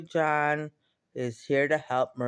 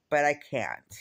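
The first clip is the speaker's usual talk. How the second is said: ordinary talk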